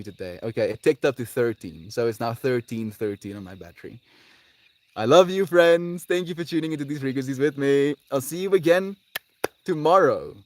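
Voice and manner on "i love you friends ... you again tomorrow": excited goodbye. Real, not acted. Excited sign-off to viewers.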